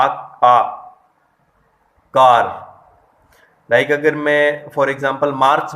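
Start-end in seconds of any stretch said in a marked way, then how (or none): 0.0-2.4 आ and कार said much louder; कार belted out to show the long आ; vowel demo, loud. Shown as a demonstration. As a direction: call out कार as the model for long आ. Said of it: "next अपने पास आ".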